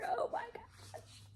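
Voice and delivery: very high, whispered and slow; awed whisper. Real, not acted. Reader overwhelmed by the confession, whispering. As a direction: gasp it out in awe. Swooning at romance.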